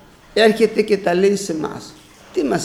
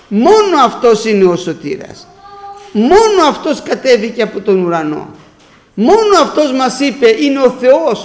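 preaching; 4 semitones higher, 4 dB louder than usual. Acted. Preacher exalting Christ to congregation.

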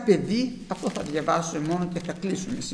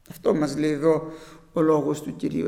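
ordinary talk

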